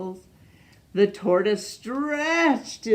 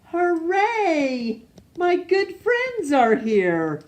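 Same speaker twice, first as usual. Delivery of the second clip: higher, louder glad cheer; joyful hooray; 8 semitones higher, 4 dB louder, slower than usual. Acted. Amos cheering as his animal friends arrive, loud and happy.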